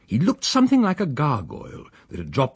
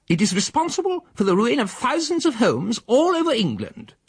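much higher, prim lecturing lady; preaching spinster; 3 dB louder than usual. Acted. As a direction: sermonise like a temperance crusader. The female lectures.

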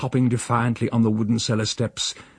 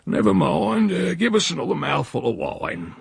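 lightly voiced rustic old man, raised pitch; evasive old uncle; 8 semitones higher than usual. A narrator's reading. Uncle dodges nephew's question, lightly rustic and evasive. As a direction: brush it off in an old countryman's voice. Voicing Uncle Silas in story.

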